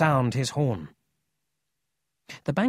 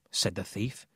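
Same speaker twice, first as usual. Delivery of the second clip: usual speaking voice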